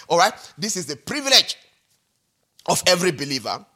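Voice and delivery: normal talking voice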